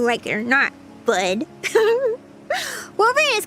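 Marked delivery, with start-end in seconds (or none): 1.5-2.1 high giggle; teasing laugh. Real, not acted. Streamer teasing with head pats, high laugh.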